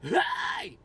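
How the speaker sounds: loud raspy yelled scream. Shown as a demonstration. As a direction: belt it from the diaphragm. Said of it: "You just know the range".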